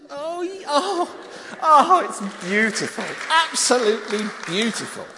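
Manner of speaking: loud, laughing admiration; delighted gasp. Real, not acted. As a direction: gush over the twirl. Admiring twirling child.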